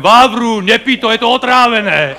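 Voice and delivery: Moravian-dialect warning, lightly done. Retold or imitated. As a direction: call out to him in Moravian dialect. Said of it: "Možná je to Moravák, tak dialektem".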